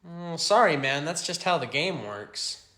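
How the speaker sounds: ordinary delivery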